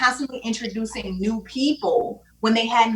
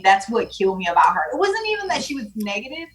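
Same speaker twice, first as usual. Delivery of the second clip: ordinary talk, nothing marked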